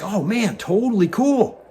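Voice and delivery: high, gushing mock delight. Pilot congratulates the former cable guy, high and delighted. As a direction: lightly ham up being thrilled for him. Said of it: "So I'm like".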